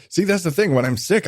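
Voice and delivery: usual speaking voice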